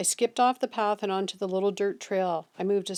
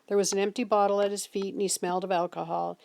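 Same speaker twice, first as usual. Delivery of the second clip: ordinary delivery, nothing marked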